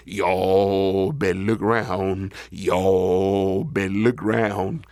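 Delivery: low, slow bullfrog call; deep, stretched-out frog voice; big frog hollering. Acted. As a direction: croon a warning, deep and drawn out. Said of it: "the big frog hollered".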